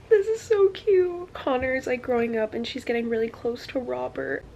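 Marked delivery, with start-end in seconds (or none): 0.1-1.3 slow, high, loud words through tears; tearful. Real, not acted. Reader moved by a sweet scene, speaking through tears. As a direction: choke it out while crying.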